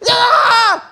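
very high loud cry of "Ah"; preacher's shout. Retold or imitated. Becky declaring over the drums, a high shout. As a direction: let out a fiery, high-pitched shout. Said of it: "and she's like".

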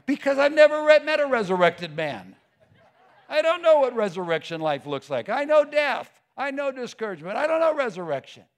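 loud, overwhelmed objection; acted protest. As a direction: argue back loudly, pitch jumping up. Acts disciple's protest to Jesus.

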